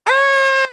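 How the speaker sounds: loud, high comic scream; high-pitched scream. Retold or imitated. Retelling the hero's reaction to Khonshu, high mock scream. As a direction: let out a sudden high shriek. Acting out character's cry in recap.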